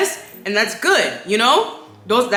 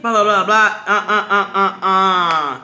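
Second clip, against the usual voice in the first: 1 laughing blah; 3 dB louder than usual. Real, not acted. Mocking negative self-talk, laughing.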